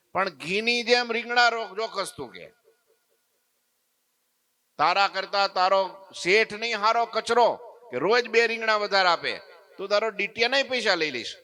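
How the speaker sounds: put-on high, loud, indignant old woman; scolding old woman. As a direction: scold him like a cheated customer.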